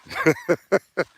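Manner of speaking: short high chuckle; amused laugh. Real, not acted. Streamer amused at having no viewers, short high laugh. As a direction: chuckle in high little bursts.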